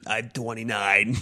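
slow raspy waitress; smoker woman voice. Retold or imitated. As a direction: drag it out like an old waitress. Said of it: "And then she's like".

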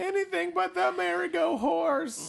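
very high, slow, squeaky whine; pleading villain. Acted. Villain begs not to ride the horse, slow squeaky whine. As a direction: drag out a cartoon villain's begging.

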